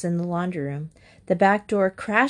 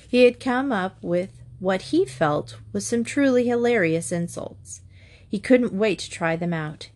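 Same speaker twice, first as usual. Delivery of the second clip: ordinary delivery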